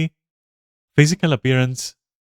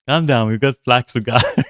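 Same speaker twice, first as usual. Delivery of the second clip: usual speaking voice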